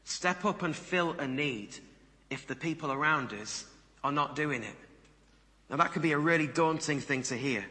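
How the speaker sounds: normal talking voice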